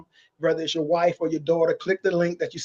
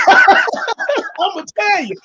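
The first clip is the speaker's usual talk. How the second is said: laughing; 13 semitones higher, 6 dB louder, much slower than usual. Real, not acted. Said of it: "I can come up with a Thin Mint rap".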